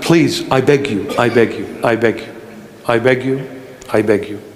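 soft, low pleading to the congregation; tender pleading. Acted. Pastor begging his beloved listeners, soft and low. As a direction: beg them gently, voice sinking.

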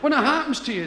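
usual speaking voice

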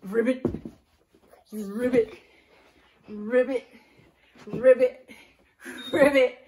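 loud playful frog croaks; frog ribbit. Shown as a demonstration. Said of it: "Let's be a frog".

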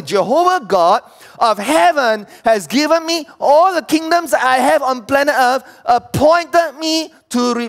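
high, loud, driving sermon; full-force preaching. Real, not acted. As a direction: preach it hard to the whole congregation.

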